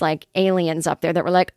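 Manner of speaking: ordinary delivery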